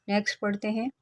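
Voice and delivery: normal talking voice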